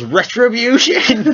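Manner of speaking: high, drawn-out cry of vengeance, half laughing; battle yell. Acted. Game master voicing a rider attacking Harvey, high yell. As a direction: yell it out slowly like a comic avenger. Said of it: "And he yells".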